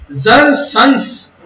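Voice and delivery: जर and sons slowly separated; syllable-by-syllable scansion. Shown as a demonstration. Teacher marking off a foot, slow split जर sons. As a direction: stretch out जर sons as one two-beat foot. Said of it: "एक ये हो गया पद".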